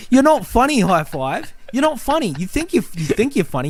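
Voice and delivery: high pitched furious yell; angry shout. Real, not acted. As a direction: scream your contempt at him. Ranting at annoying character.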